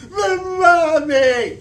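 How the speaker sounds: high, loud, slow crying protest; a little girl beginning to sob; sobby plea. A narrator's reading. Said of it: "starting to get all sobby".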